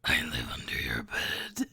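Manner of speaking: very low, slow whispered growl; creepy monster voice. Pretend. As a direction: creep them out from under the bed.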